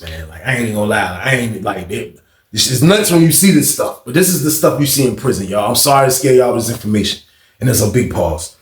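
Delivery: ordinary delivery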